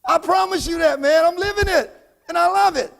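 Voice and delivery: high, impassioned exhortation; motivational preaching. Real, not acted. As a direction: testify with rising fervor. Fires up the crowd.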